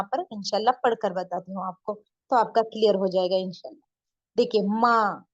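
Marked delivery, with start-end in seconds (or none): 4.6-5.3 माँ drawn out through the nose; nasalised long vowel. Shown as a demonstration. Urdu teacher showing learners noon ghunna, nasal drawn-out vowel. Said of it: "नून के sound नाक में जाकर".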